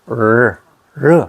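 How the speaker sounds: "르" drawn out slowly as a tongue-tip r; slow Korean "르" demo; slow r sound demo. Shown as a demonstration. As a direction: stretch out "르" to show the r. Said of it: "알 발음이 돼버리는 거예요".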